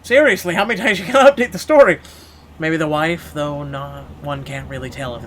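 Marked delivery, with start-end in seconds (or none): 0.0-2.0 much louder, higher and fast, fed up; exasperated outburst. Real, not acted. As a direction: blurt it out in real annoyance. Exasperated aside at the story.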